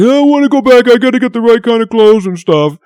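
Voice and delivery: mock friend refusing to wait; whiny friend. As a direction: whine like the stubborn friend. Friend voiced in retold story.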